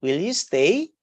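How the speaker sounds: "Will you stay" with the pitch climbing on STAY; rising intonation. Shown as a demonstration. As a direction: end "Will you stay" on a clear question rise.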